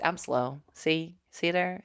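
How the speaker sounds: ordinary talk, nothing marked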